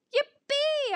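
very high squealed cheer; high-pitched, giddy yippee; gleeful bark. A narrator's reading. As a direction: whoop like an overjoyed pup. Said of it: "I bark".

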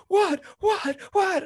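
high, panicky repeated question; sharp, high-pitched yelps of alarm; imitated alarmed wife. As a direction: mimic her rising alarm in a high voice.

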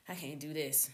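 normal talking voice

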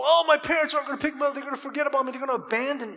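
fast, high whiny kid wail, lightly played; mock crying kid. Boy left after baseball practice, mock whimpering, fast and high. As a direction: imitate a panicked child afraid of being forgotten. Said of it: "he's crying".

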